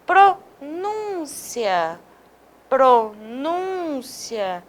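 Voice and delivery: pronúncia drawn out very slowly, stress on nún; slow stress demo. Shown as a demonstration. Teacher repeating the word so students hear the stress, very slow. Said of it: "E olha essa outra".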